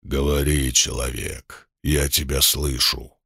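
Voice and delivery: low, slow, menacing demon whisper-like voice; insinuating sinister voice. Acted. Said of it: "вкрадчивый, зловещий голос".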